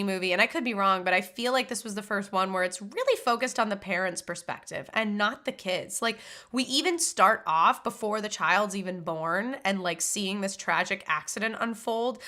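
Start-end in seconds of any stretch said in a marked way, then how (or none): none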